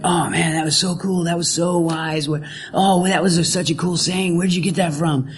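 a hint of a mellow hippie, gently impressed. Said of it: "so I'm gonna share my hippie voice".

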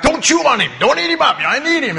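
high, loud urgent order; hammed-up frantic command; shouted warning. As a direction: shout it like God scolding a whale. God scolds the whale.

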